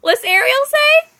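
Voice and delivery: high playful repeat of the question; sing-song question. Real, not acted. Asking toddler again.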